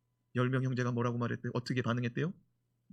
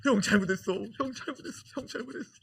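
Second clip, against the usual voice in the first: pleading Joseph; 8 semitones higher than usual. Retold or imitated. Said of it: "요셉이 뭐라고 말했을까요".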